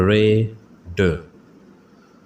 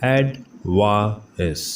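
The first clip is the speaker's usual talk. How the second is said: Advertising stretched out very slowly; teacher slowly modelling the word for students; slow syllable by syllable; much slower than usual. Shown as a demonstration. Slow, careful pronunciation drill. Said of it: "pronunciation drill".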